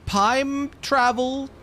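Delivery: "Time travel" stretched slow and high; drawn-out amazement. Pretend. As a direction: hang on each word of "Time travel". Exclaims the discovery slowly.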